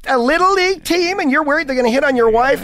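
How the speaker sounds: very high, outraged disbelief; incredulous caller. Retold or imitated. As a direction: squawk it like an appalled radio listener. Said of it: "people are finally go".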